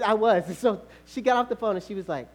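usual speaking voice

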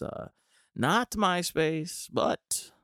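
ordinary delivery

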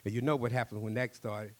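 usual speaking voice

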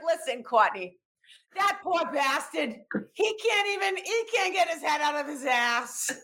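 imitating a mother's thick Boston accent. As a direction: scold in a put-on Boston mom accent. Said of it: "Possibly the best Boston accent".

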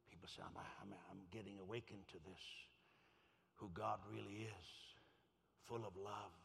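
low, measured pastoral appeal; gentle altar call. Real, not acted. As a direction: invite them softly with heads bowed. Leads altar call, heads bowed.

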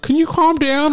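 very high-pitched mock complaint; imitating annoyed woman. Woman getting kicked in the crowd, imitated high-pitched complaint. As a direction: put on a high voice, pleading for calm. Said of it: "some chick was".

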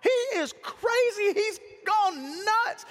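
high, incredulous onlooker voice; mock outrage. Onlookers declaring David has lost it, high mock outrage. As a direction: cry it out high, dragging the last words. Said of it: "I was looking at them going".

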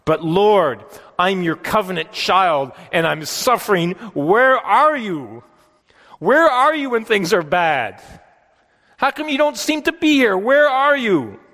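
a high, aching plea to God, slowing on where are you; pleading prayer. Retold or imitated. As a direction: cry out to the Lord as a hurting child. Voicing a suffering believer's prayer.

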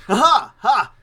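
loud, high theatrical ha-ha; hearty mock laugh. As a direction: belt out a stagey gentleman laugh. Hammy laugh in character.

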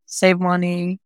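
ordinary delivery

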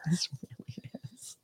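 hushed agreeing whisper. Real, not acted. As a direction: whisper back in on the joke. Whispered reply.

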